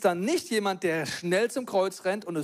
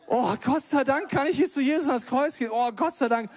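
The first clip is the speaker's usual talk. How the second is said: higher, bright exclamation of thanks; joyful relief; 6 semitones higher than usual. Acted. Believer overjoyed to go to the cross, excited relief. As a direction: burst out with grateful joy.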